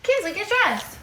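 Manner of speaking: high-pitched order across the house; impatient calling. Real, not acted. Mentor ordering a housemate to get dressed, calling out. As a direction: call out to hurry her up.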